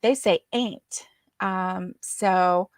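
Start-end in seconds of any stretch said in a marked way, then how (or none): 0.5-1.1 "ain't" for aunt, with a long ay; "ain't" rhyming with "paint"; West Virginia "aunt". Shown as a demonstration. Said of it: "part the accent and then just the way they say it".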